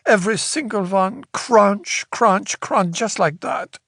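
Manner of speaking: hammed-up Slavic socialite grievance; dramatic complaint. A narrator's reading. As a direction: play up the abuse for the reporter.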